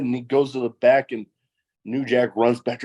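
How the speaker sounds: ordinary delivery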